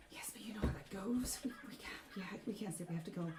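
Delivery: soft, half-whispered plea to leave; hushed urging. Acted. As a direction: coax in a near whisper, halting.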